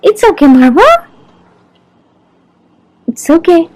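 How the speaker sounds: calm the whining dog down; soothing the dog. Real, not acted. Comforting the crying dog Marvel, a high soothing call.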